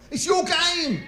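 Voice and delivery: indignant. Acted. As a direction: throw it at them in disbelief. Mock outrage at audience.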